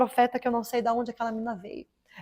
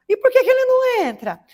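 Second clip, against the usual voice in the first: much higher, louder pushy question; a nosy church sister calling out why he won't come in; insistent church sister; 14 semitones higher, 9 dB louder than usual. Retold or imitated. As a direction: push the question at her, nosy and loud. Church sister presses about husband.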